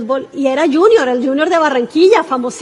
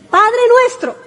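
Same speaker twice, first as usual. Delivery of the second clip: raised, high-pitched address to God; proclaimed prayer; 8 semitones higher, slower than usual. Acted. Preacher quoting Jesus teaching how to pray, proclaiming. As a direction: call out the prayer's opening in a high voice. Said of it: "así dice Jesús".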